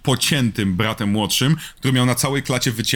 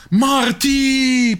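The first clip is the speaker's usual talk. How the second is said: high, long drawn-out name call; demonstrating a sung-out yell, high-pitched; mock calling out; 13 semitones higher than usual. Shown as a demonstration. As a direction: call after someone, stretching the last syllable. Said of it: "ciężko jest jednosylabowo fajnie krzyczeć".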